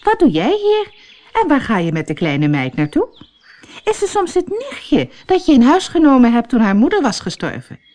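nosy friend prying for news; a hint of an eager village gossip; lightly voiced village friend. Barbie voiced by narrator.

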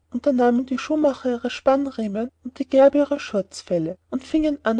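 usual speaking voice

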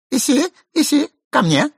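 high-pitched call to a pet; lady calling dog. A narrator's reading. Mistress to her disobedient dog, high calling. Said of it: "закричала хозяйка".

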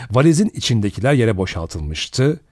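ordinary delivery, nothing marked

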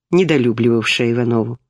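plain reading voice

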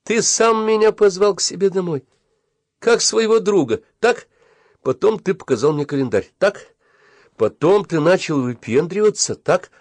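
high, indignant list of grievances. A narrator's reading. Boy lists his friend's offences, indignant. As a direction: press each point home, pitched high.

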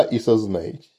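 soft, low menace; drawn-out hiss. A narrator's reading. Master pressing the girls to confess, softly hissing. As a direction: finish quietly with an understated threat. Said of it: "прошипел я, растягивая слова".

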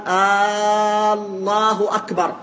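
الله with its opening vowel stretched into a long "aaa"; drawn-out first hamza. Shown as a demonstration. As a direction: hold a long آه before الله أكبر. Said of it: "فإن مد همزة الله".

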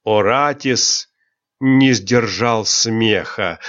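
ordinary delivery

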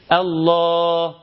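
long held الله ending on an open aa, no h; final ha dropped. Shown as a demonstration. Teacher giving an example, final ha left silent. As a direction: stretch الله and leave off its last h. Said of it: "ما نطقتش حرف الهاء أصلًا".

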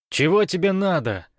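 high, loud desperate plea; pleading. A narrator's reading. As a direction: beg him to stop. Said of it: "взмолился Олег".

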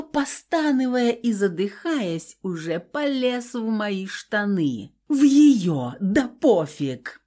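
usual speaking voice

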